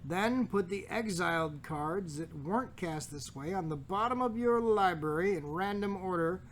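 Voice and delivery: usual speaking voice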